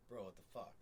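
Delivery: "Bro, what the fuck?" run together like two words; casual blended slang. Shown as a demonstration. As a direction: blur "what the" into one quick slurred bit. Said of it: "it's almost like two words".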